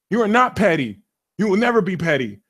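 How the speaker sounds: usual speaking voice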